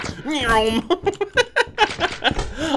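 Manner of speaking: playful high "nyoom"; drawn-out cartoon zooming sound; imitated zoom noise. Gamer mimics sprinting through the store, playful zoom.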